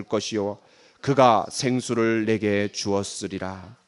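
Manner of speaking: slow, low, measured recitation; scripture reading. Acted. Preacher leading the congregation in reading Jesus' answer, scripture reading. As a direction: read evenly and slowly for others to follow.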